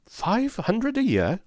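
stunned outburst at the offered salary; astonished shout. A narrator's reading. Clerk hears the salary offer, shouting in astonishment. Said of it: "I shouted".

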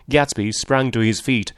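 ordinary delivery, nothing marked

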